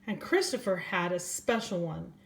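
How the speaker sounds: gentle storybook reading voice; reading to children. A narrator's reading. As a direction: read it like bedtime story time for little ones. Reads a picture book aloud.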